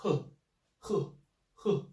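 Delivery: he dropped hard and strong; strong fourth tone. Shown as a demonstration. Teacher showing the falling fourth tone on he. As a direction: snap each he down. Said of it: "strong, very strong".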